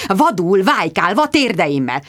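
usual speaking voice

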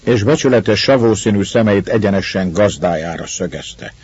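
plain reading voice

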